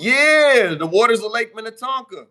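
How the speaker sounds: loud, high, triumphant outburst; excited confirmation. Real, not acted. Streamer proving to a doubter where [name] is from, excited. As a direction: belt it out as clinching proof. Triumphant proof of his claim.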